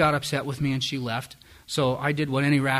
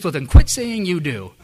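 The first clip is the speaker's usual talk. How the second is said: firm mom; 4 semitones higher, 11 dB louder, slower than usual. Retold or imitated. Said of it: "She says".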